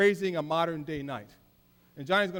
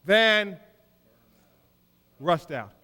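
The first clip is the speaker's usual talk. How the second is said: proud slogan; 3 semitones higher, 6 dB louder, slower than usual. Retold or imitated. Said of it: "we'll say things like".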